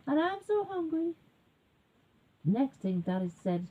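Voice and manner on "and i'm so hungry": high, mock-tearful whine; hungry little bear. A narrator's reading. Little bear left hungry, whining pitifully. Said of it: "And the little bear says".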